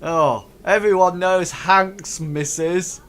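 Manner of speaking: slow, loud tavern-rough jeer; ruffians. Acted. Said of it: "they sort of, like, says".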